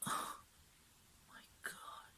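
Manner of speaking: very slow, hushed whisper; awed whisper. Real, not acted. Fan overcome by her heroine's look, awed whisper. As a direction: whisper it, drawn out and almost soundless.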